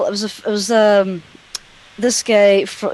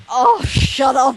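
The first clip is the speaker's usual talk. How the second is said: higher, drawn-out exasperated plea; mock exasperation; 5 semitones higher, slower than usual. Agent stuck listening to slow caller, exasperated groan. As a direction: drag it out, pitched up in mock despair. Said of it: "you're sitting there going".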